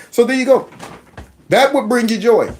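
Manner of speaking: usual speaking voice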